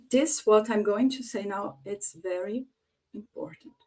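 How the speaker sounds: slowing to a hush, pausing before "important"; pause before important. Shown as a demonstration. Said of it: "you should do a pause".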